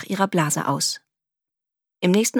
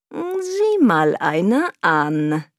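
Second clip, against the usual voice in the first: very slow, gloating hiss; much slower than usual. A narrator's reading. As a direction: savour it, gloating over the caught girl. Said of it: "zischte er".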